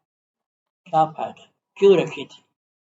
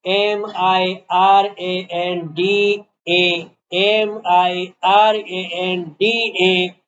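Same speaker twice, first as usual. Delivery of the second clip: drawn-out letter-by-letter spelling; much slower than usual. Real, not acted. Teacher spelling Miranda for students writing, slow spelling. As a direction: spell very slowly, each letter on its own.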